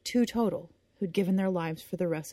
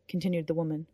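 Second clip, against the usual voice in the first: ordinary delivery, nothing marked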